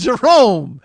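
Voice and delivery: high, loud summons; acted call across the room. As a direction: holler the name to bring someone over. Acts Morris calling someone over.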